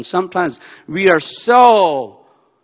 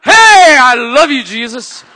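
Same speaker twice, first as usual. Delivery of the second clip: shrieking high then loving; wild mood swing; 11 semitones higher, 8 dB louder than usual. Retold or imitated. Believer swinging wildly between moods, shrieking. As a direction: flip from despair to joy. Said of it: "like a schizophrenic".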